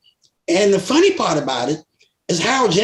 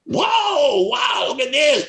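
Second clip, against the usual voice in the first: mock amazement; 8 semitones higher, much slower than usual. Girl marveling at Central Park.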